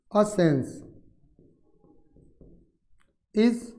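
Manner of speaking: word by word with very long pauses; dictation. Shown as a demonstration. Teacher gives students the word definition, slow dictation. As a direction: dictate slowly, leaving time to write.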